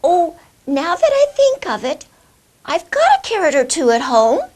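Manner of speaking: put-on high, loud old lady call. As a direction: call out like an eager old woman. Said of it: "an old lady called out".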